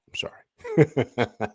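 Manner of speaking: breathless whispered sorry through a laugh; laughing apology. Real, not acted. Streamer apologizing to chat for ranting, laughing whisper. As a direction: giggle the apology out slowly. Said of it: "I'm getting a little emotional".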